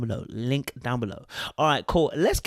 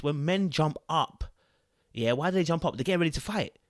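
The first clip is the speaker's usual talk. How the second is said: ordinary talk, nothing marked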